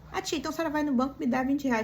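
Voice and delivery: put-on street kid. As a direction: push your luck like the kid. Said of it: "Aí ele falou".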